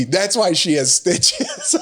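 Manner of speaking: put-on slow high kid line through laughter; laughing kid. Dad laughing as he quotes his son's punchline, high and giggly. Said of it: "And he goes".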